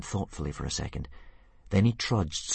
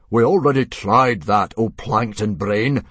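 loud, scornful chief; scornful shout; 2 semitones higher, 10 dB louder than usual. Acted. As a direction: bark the insult. Stoic snaps at Mogadon.

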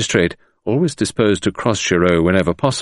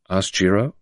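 plain reading voice

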